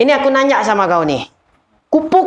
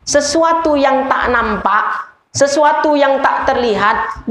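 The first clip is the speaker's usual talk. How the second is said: slow emphasis; 2 semitones higher, slower than usual. Shown as a demonstration. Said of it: "slowed emphatic teaching".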